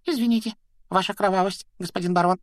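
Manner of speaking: high, squeaky grovelling; obsequious. A narrator's reading. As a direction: fawn before your master in a shrill imp voice. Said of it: "подобострастно заюлил он".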